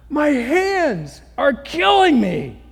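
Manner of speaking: high, loud, slow whiny groan; mock complaint. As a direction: ham up a petty gripe. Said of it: "He didn't say".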